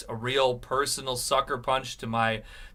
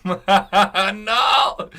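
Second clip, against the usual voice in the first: laughing; 5 semitones higher, 6 dB louder than usual. Real, not acted. Laughing protest.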